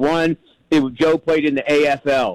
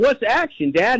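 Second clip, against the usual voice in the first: retold yell; 7 semitones higher than usual. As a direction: imitate yourself as a kid yelling to dad. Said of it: "I'm yelling".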